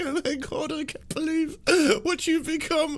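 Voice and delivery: very high, falsetto mock sob-talk; crying mom. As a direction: imitate the mom breaking down in tears.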